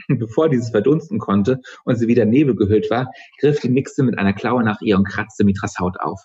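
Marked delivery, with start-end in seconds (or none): none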